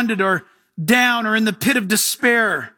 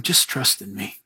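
much lower and softer, calm and tender; still small voice; 14 semitones lower, 5 dB quieter than usual. Acted. The Lord answering the panicked believer, low soft reassurance. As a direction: soothe a thrashing child. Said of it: "you hear him saying".

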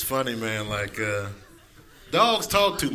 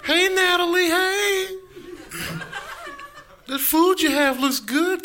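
higher, pleading dog voice; begging dog; 10 semitones higher than usual. Retold or imitated. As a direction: beg for food like a hungry dog. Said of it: "they just look at you like".

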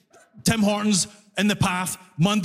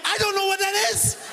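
panicked cashier; 10 semitones higher than usual. Retold or imitated. New immigrant cashier yelling back to customer, high and panicked. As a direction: yelp it out in a panic. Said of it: "And I'm like".

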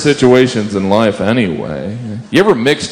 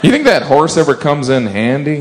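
usual speaking voice

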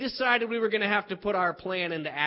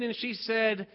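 usual speaking voice

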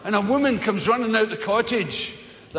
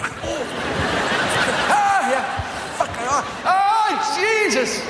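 mock swatting yelps; 9 semitones higher, much slower than usual. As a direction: yell as if eaten by midges. Acting out midge attack.

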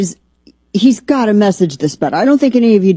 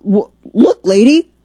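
usual speaking voice

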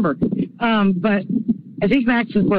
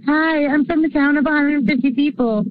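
mock Midwestern twang, pitched up; silly Midwestern accent; 6 semitones higher than usual. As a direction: tease her with a hammed-up small-town Midwestern voice. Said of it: "and a silly Midwestern accent".

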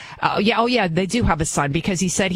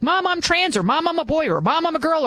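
higher, lightly voiced child; mock kid; 7 semitones higher than usual. Host imitating a child coming out to mom, lightly higher. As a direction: play a kid announcing it to mom. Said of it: "they're like".

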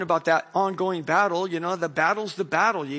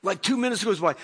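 usual speaking voice

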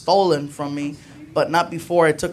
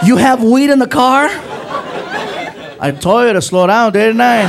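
loud, higher mock outrage; hammed-up loud reproach; indignant scolding; 4 semitones higher, 5 dB louder than usual. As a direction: scold the passenger in disbelief. Acted quarrel in the car.

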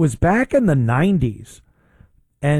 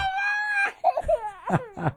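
mock wail; 7 dB quieter than usual. Mocking De Niro crying.

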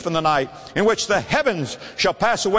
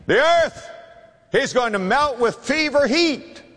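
shouted warning; 9 semitones higher than usual. Acted. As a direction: ring out the warning high and strong. Preacher warns of the judgment.

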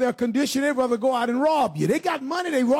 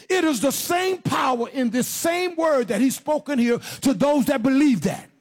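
preaching at full force; 5 semitones higher than usual. Acted. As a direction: build it up and drive it home. Preaching build.

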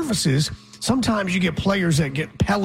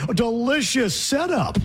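mock American announcer; 7 semitones higher than usual. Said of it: "and you go".

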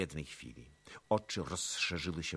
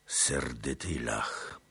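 low, slow, a different thoughtful voice; slow repeat; 3 semitones lower, much slower than usual. A narrator's reading. Chmielnicki repeating Tuchaj Bej, slow and low. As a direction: echo the words slowly, weighing them. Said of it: "powtórzył Chmielnicki".

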